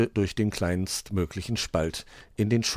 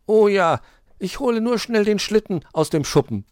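high, loud outburst; delighted eagerness; 14 semitones higher, 9 dB louder than usual. A narrator's reading. Weißohr rushes to fetch the sledge, delighted. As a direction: jump at the offer. Youngster delighted by sledding offer.

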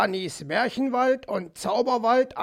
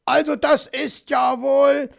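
higher, louder indignant cry, breaking off after wohl; indignant outburst; 5 semitones higher, 5 dB louder than usual. Acted. Magpie shouting indignantly at the fox in their quarrel. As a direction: sputter with outrage.

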